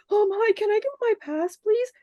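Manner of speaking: squealing high, eager fan voice; high-pitched overexcited plea; giddy fan. Retold or imitated. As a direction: beg for the pass like a thrilled nerd. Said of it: "I was, like, so excited".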